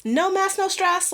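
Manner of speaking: high, breezy acted apology; mock-agreeable, pitched well up; easygoing apology. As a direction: play a quick no-hard-feelings reply up high. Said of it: "Be like".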